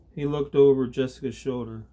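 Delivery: ordinary delivery, nothing marked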